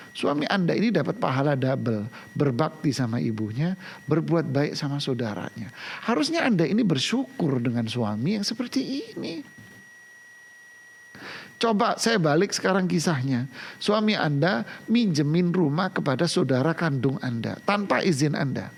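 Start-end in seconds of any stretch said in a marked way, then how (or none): none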